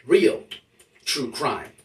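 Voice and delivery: usual speaking voice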